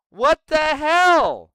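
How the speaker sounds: drawn-out disgusted yell; outraged shout. Real, not acted. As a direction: bellow it in disgust. Ranting at raccoon story.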